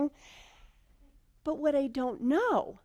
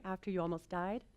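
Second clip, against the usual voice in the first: usual speaking voice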